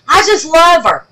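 squealing fan love; gushing. Shown as a demonstration. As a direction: burst out adoring her.

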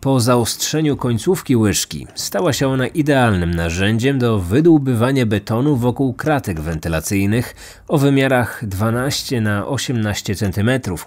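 ordinary delivery, nothing marked

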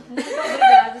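high, loud, laughing thanks; excited thanks. Real, not acted.

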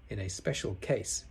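ordinary delivery

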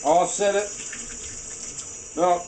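talking through a mouthful; words around a bite of food; mouth full. Real, not acted. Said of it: "I have food in my mouth".

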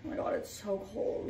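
breathy freezing whisper. Real, not acted. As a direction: whisper it while shivering cold. Repeats cold complaint, whispered.

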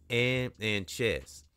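ordinary delivery, nothing marked

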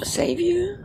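high incredulous retort. Real, not acted. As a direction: toss the question back in disbelief. Reacting to the character's question.

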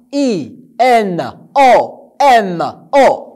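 I N O M O, letter by letter, slowly; slow spelling. Shown as a demonstration. Teacher slowly spelling letters for the children. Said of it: "on a K".

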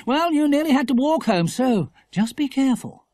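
lightly exasperated motherly warning. A narrator's reading. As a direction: caution the boy firmly. Mother warns teasing son.

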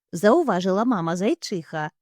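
usual speaking voice

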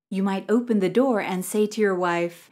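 usual speaking voice

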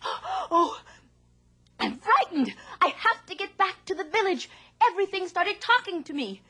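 high, breathless panicked retelling; frightened farmer. Acted. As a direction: gabble it out in a fright. Man tells fisherman, panicked.

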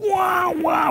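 high, drawn-out "waah" cry; imitated pained scream. Retelling a worshipper's cries at the mosque, imitated pained wail. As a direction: mimic someone wailing in pain. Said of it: "berteriak kesakitan".

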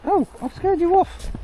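very high, very slow squeaky voice; llama voice. Pretend. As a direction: talk like a cartoon llama. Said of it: "can you now talk like a llama for me?".

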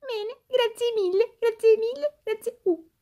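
high, chirpy toy doll voice; squeaky doll voice. Acted. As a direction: play a cheeky little doll.

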